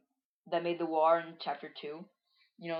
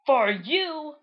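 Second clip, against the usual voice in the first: high, loud cartoon comeback; hammed-up taunting yell; shouted retort; 9 semitones higher, 9 dB louder than usual. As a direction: fling it back in a raised shout. Character retorts in match.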